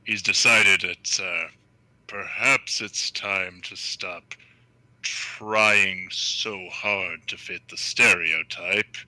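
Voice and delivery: low, slow and relaxed orc voice; Torgon's real voice. Acted. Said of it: "put myself in the voice".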